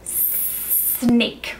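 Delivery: whispered snake with a long S hiss. Shown as a demonstration. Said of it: "pair it with this gesture".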